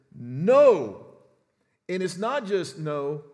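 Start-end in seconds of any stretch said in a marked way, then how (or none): 0.1-1.0 a sudden, loud, firm No; mother's flat refusal; emphatic no. Retold or imitated. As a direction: slam the door shut with one word. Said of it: "it is emphatic".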